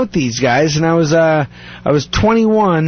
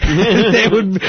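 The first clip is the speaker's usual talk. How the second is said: normal talking voice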